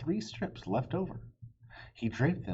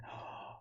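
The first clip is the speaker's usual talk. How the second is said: soft gasp; 12 dB quieter than usual. Acted. Gasp at bad news.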